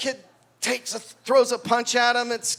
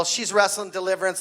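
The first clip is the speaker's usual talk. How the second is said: ordinary talk, nothing marked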